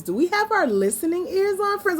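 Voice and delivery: Miss Rachel voice. Retold or imitated. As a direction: coax toddlers sweetly like a preschool host. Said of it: "talk to y'all like Miss Rachel".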